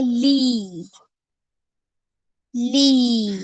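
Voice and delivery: ली with the ee held long; long drawn-out vowel. Shown as a demonstration. Teacher shows ल with long ई, drawn-out ली. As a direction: stretch the vowel of ली evenly. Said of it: "ऐसा लंबा खींचा जाता है".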